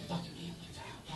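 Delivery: breathy whisper.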